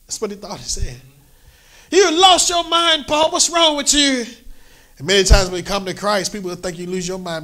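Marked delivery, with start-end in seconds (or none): 1.9-4.3 high, loud scolding of Paul; Festus accusing Paul. Acted. Festus shouting at Paul that he is mad.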